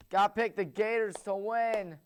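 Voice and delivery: normal talking voice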